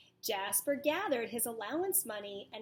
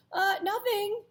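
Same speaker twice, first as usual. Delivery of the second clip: high, louder startled yelp; 9 semitones higher, 7 dB louder than usual. Acted. Jasper yelps nervously when his mom asks what's wrong, startled. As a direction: blurt it out like a startled rabbit. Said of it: "he yelped".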